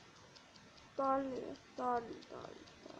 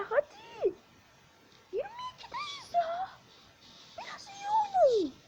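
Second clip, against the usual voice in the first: very high, strained plea to the team; squeaky pleading. Real, not acted. Gamer begging his teammates to play better, squeaky. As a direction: beg them in a squeaky falsetto.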